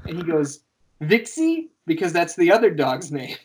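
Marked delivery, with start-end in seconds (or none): 1.0-1.7 put-on high-pitched little child's guess; squeaky-high mock toddler answer; imitated toddler. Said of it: "just starting to speak".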